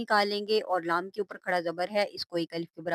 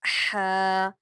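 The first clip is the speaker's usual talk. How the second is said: ordinary talk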